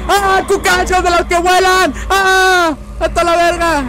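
high panicked yelps at flying roaches; startled scream. Real, not acted. Gamer yelping at flying cockroaches, panicked. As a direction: shriek in real fright.

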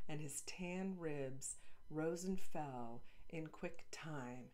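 ordinary delivery, nothing marked

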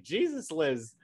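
high-pitched pretend heckle, dipping mid-way; mock exasperated onlooker. Someone walks in on the queen, mock exasperated. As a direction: play someone walking in and chiding, high-voiced. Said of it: "someone walks in like".

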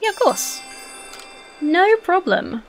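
high, drawn-out breezy agreement; mock cheery reply. To a game villager, a breezy high no problem. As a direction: answer the villager like it's no trouble at all.

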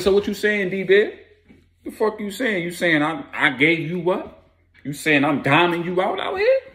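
high, incredulous acted comeback; indignant challenge. Accused man challenges his accuser.

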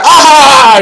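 loud, high "Ah!" of mock surprise; imitated startled shriek. Astrologer acting out the Pretty Woman scene, startled shriek. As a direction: yelp as if a box snapped shut. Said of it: "she's like".

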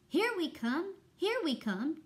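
high singsong character call; tooting clarinet. A narrator's reading. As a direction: toot it out like a clarinet. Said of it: "tooted clarinet".

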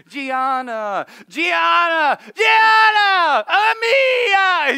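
building from plain call to a high, loud shout; calling kids' names. Retold or imitated. Father calls his daughters from downstairs, rising shout. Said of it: "it starts with".

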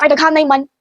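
ordinary talk, nothing marked

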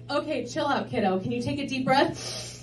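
soft, soothing retold voice; calming babysitter. Babysitter to upset five-year-old, softly calming. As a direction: talk a small child down quietly.

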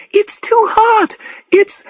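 very high, drawn-out cry; panicked cry. A narrator's reading. Woman's last words in the boiling lake, panicked cry. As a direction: cry out slowly and high, cut off mid-phrase. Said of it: "she cried".

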